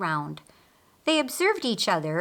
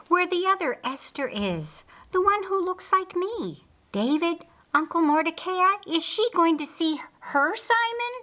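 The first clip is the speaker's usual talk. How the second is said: eager questions; 8 semitones higher than usual. A narrator's reading. Queen Esther eagerly questioning David.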